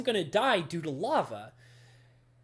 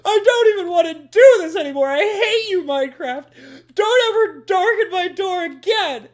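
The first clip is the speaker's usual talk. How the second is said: shouted, much higher and louder rage, cracking into a laugh; hammed-up furious shout at Minecraft; mock rage quit; 10 semitones higher, 13 dB louder than usual. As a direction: yell it like slamming the door on the game. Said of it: "I'm like".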